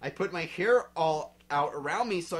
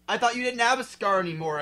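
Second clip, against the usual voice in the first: higher, fast shout; 6 semitones higher, 6 dB louder, faster than usual. Acted. Girl shouting to Vampire, fast and raised. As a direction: call out to him quickly. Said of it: "I shouted".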